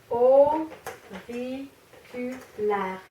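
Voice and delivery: slow "Auriculaire" in four beats; syllable by syllable. Shown as a demonstration. Teacher confirming four syllables, syllable by syllable. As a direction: separate each syllable of "Auriculaire". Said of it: "quatre syllabes".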